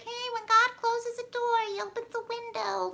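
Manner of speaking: high, sing-song greeting-card voice; hammed-up inspirational poster cliche. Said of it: "Still gotta say it in the cliche voice".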